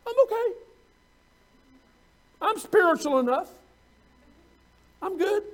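mock the smug believer lightly; complacent self-talk. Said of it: "If you're saying to yourself".

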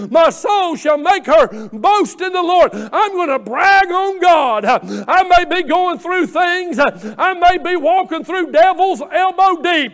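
rising sermon climax; fervent preaching. Acted. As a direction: lift it into full gospel fervor. Preaching at full force.

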